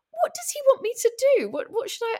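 high, fast, flustered questions; confused panic. Retold or imitated. [name] not knowing what Jack Dee wants, high and flustered. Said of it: "So I was like".

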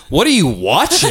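high, startled mom voice; a parent catching the kid mid-movie; mom's alarmed question. Retold or imitated.

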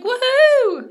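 high loud whoop; cheering. Retold or imitated. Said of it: "she's like".

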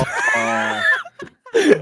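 loud, high burst of laughter; real high-pitched howl of laughter; shrieking laugh. Real, not acted. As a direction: crack up helplessly at a friend's story. Listener cracks up at the story.

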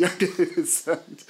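amused, voice lifting into a laugh. Real, not acted. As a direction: say it through a burst of laughter. Laughs at own young innocence.

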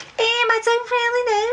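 high-pitched Scouse demonstration; full Scouse. Shown as a demonstration. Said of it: "you could either go full Scouse".

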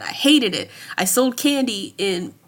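ordinary delivery

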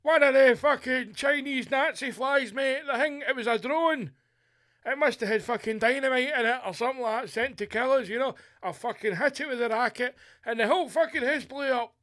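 high, frantic pensioner's account to police; hammed-up outraged old man explaining the disaster; agitated old man. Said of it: "Right, Mister McGregor".

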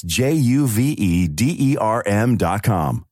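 each letter spelled out slowly. Acted. Ad voice spelling the web address slowly.

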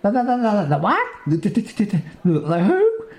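high, laughing stutter; stammering. Acted. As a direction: stumble over every the. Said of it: "Stammers Moonbeam".

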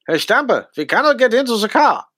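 hammed-up German accent, imitating an actor's attempt. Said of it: "Do it in a German accent".

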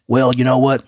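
lightly voiced good-ol'-boy quip; a hint of a Southern twang; drawled Southern retort. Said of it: "I got lost in the voice".

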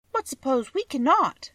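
put-on high girl's voice; Dorothy. A narrator's reading. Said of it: "said the girl".

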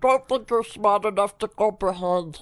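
throat-closed strained put-on voice; ridiculous voice. Host disses cohost in a throat-closed voice. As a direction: keep the throat squeezed and tease. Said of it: "diss you in a fucking ridiculous voice".

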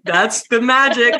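high laughter running through the words; laughing. Real, not acted. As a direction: keep laughing as you speak. Laughter yoga warm-up, laughing together.

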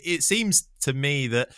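usual speaking voice

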